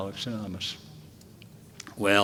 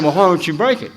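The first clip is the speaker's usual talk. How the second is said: teasing laugh; 7 semitones higher, 8 dB louder, faster than usual. Retold or imitated.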